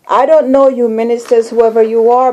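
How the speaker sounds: ordinary delivery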